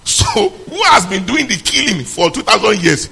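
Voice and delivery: slow, loud, high accusing shout; preaching shout. Real, not acted. Preacher confronting his congregation, slow loud shout. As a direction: hurl the question at the crowd.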